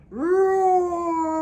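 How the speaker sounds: big loud dinosaur roar, full voice; a booming roar for the kids. Shown as a demonstration. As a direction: let the roar fill the room. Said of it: "very loud for me".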